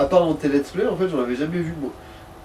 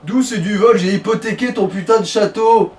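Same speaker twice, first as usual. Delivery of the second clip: indignant outburst; 5 semitones higher, 5 dB louder than usual. Acted. Indignant retort to friend.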